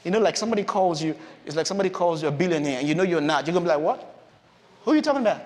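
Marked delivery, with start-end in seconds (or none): 3.8-5.4 higher, baffled question; mildly incredulous comeback; acted disbelief. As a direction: react as if they've mistaken you. Gideon doubting God's flattering call.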